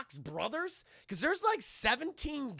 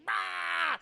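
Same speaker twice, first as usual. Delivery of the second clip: mock battle cry; 10 semitones higher, 4 dB louder than usual. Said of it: "he goes".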